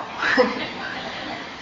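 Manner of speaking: breathy, quiet little laugh; soft laugh. Real, not acted. Still laughing softly at the mangled word, amused. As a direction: let out a small, self-amused laugh. Laughing at her own mispronunciation.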